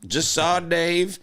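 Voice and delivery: slow, high mock voicemail drawl; imagined caller impression. Inventing the caller's next voicemail about Dave, mock slow caller voice. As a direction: draw it out high, as the odd caller would.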